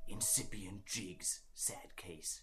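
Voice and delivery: hushed confidential whisper. Acted. Ned whispers to O'Molloy while passing out. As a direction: whisper it aside, very softly. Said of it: "he whispered to J J O'Molloy".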